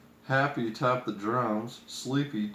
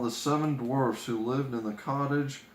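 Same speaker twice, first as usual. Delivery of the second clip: ordinary delivery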